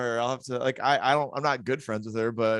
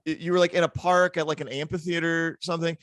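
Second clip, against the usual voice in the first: ordinary talk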